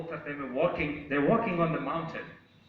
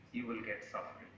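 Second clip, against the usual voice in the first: low, hushed answer; solemn consequence; 6 semitones lower, 15 dB quieter, faster than usual. Acted. Son states nature's lesson on suffering, solemn and hushed. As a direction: drop right down for the lesson.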